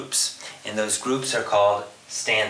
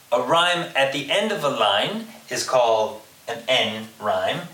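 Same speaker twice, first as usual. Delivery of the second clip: ordinary talk, nothing marked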